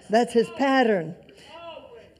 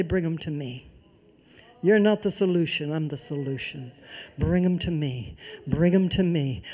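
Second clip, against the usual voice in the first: voice of the Lord; 6 semitones lower than usual. Acted. Preacher voicing the Lord to proud believers, low and gentle. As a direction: speak as the Lord, low and calm. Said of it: "does the voice of the Lord say".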